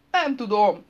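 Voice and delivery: high, loud cry; desperate shout. A narrator's reading. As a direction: cry out helplessly. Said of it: "kiáltott". (kétségbeesett, hangos kiáltás)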